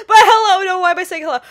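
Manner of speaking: loud, high self-correction; flustered yelp. Real, not acted. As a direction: catch yourself mid-hello. Wrong greeting again.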